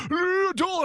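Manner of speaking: high mock voice of the boy; mimicking pool boy. Soldier imitating the pool owner's son, high mock voice. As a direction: toss it off like the kid.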